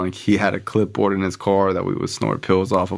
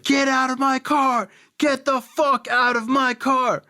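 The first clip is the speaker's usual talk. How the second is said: imitated screaming. Friend orders him out of the car, mock screaming. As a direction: mimic a panicked driver's scream. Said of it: "he's screaming".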